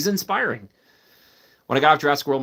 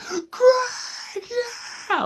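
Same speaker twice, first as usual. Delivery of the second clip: calling out. Retold or imitated. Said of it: "I'm like".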